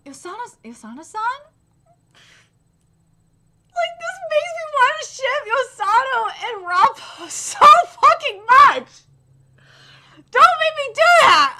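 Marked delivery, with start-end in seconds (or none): 3.7-11.6 high loud giddy squeal; shipping squeal. Real, not acted. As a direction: beg the manga to stop. Shipping excitement.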